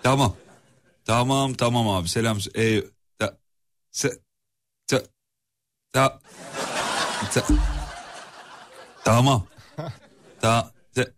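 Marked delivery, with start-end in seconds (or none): 2.6-11.1 breaking up, stuttering syllables; words cut off by bad signal; bad connection. Real, not acted. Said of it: "Ver, ver, çekmiyor".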